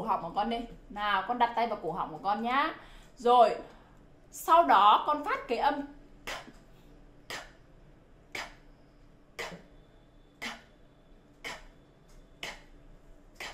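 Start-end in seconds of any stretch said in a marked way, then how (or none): 6.3-13.4 bare "k" as a voiceless puff of air; short bursts of breath on "k", no vowel, spaced out; aspirated k. Shown as a demonstration. Teacher drills the throat test, voiceless aspirated "k". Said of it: "bật hơi và con phát cái âm k".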